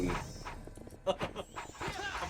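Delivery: drawn-out chuckle; laugh. Real, not acted. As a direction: laugh it out softly. Laughing during the game.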